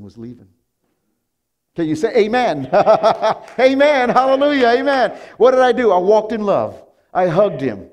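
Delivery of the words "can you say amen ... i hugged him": loud, high jubilant preaching; preaching at full force. Acted. Pastor celebrating with the congregation, loud jubilant preaching. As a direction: lift the church to its feet.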